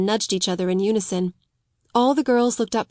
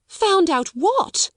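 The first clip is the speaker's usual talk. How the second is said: much higher, slow, rising disbelief; exclaiming; 10 semitones higher, much slower than usual. Acted. Headmistress demanding the secret, slow startled question. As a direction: drag the question out in shock. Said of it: "she exclaimed".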